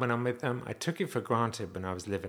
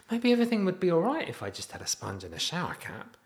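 mock wistful; 8 semitones higher than usual. Real, not acted. Comic wistful musing.

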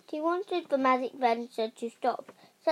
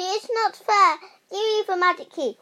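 high, loud complaint; raised protest; 8 semitones higher, 7 dB louder than usual. A narrator's reading. Rosie protesting to the children who have the key, loudly. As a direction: insist on it, higher and louder.

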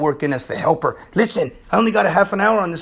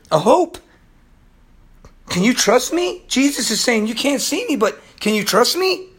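higher, louder pleading voice of Jesus; voicing Jesus; 5 semitones higher, 4 dB louder than usual. Preacher voicing Jesus to the listener, rising plea. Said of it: "And Jesus is saying".

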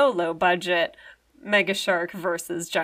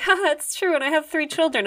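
high, bright imitation of a woman laughing it off; breezy mock-casual woman, pitched up; mock woman's voice; 7 semitones higher, 5 dB louder than usual. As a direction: lightly voice her breezy reply, pitched up. Voicing the woman's reply.

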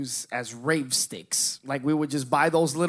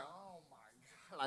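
barely audible stoned awe; very soft, drawn-out amazement; blissed-out murmur; 23 dB quieter, slower than usual. Retold or imitated. Stoned boy marvelling on the couch, very soft and slow.

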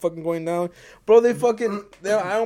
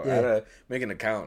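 normal talking voice

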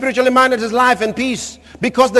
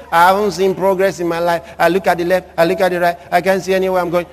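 low-pitched mock dejection; imitating a hopeless grumbler; discouraged complainer; 5 semitones lower, faster than usual. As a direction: recite the woes in a low, defeated voice. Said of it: "Stop saying".

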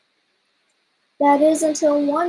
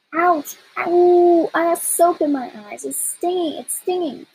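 pained yelps; 6 semitones higher, 3 dB louder than usual. Acted. Soap in dinosaur's eyes.

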